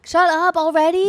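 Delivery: loud, high shut up; excited disbelief. Real, not acted. Hearing a friend's news, a loud high squeal.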